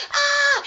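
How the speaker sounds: high mock yelp of pain; hammed-up "ah" of agony; short acted shriek; pained scream.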